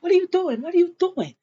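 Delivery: high, agitated repeated question; retold startled objection. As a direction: act out an alarmed husband.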